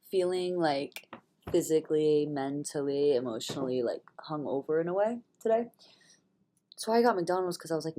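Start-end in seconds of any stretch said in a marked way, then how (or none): none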